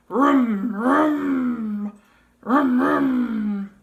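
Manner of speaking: loud playful engine vroom; engine rumble. Acted. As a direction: rev it up like a toy car. Car engine noise for baby.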